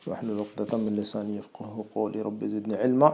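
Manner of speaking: soft, low recited Arabic supplication; hushed Quranic recitation before a lesson; opening prayer. Acted. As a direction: recite the opening duas quietly and devoutly.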